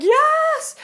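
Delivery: high, loud excited yes from the caller; imitating a caller's thrilled yes. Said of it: "And she's like".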